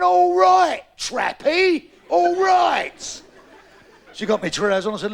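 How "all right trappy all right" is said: higher, louder mocking sing-song; the wife's high, loud mocking give-in; wife mocking her husband with a loud, high all right. Wife mocks husband back.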